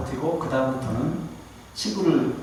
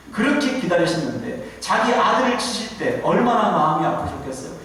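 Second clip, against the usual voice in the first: raised, impassioned pulpit voice, pitch well up; fervent preaching; 6 semitones higher, 4 dB louder than usual. Acted. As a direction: preach it hard to the congregation. Preaching at full force. (힘차게 외치는 설교)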